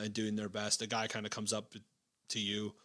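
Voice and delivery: ordinary talk, nothing marked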